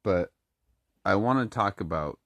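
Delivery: ordinary delivery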